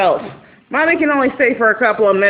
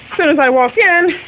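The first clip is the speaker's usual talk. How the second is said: normal talking voice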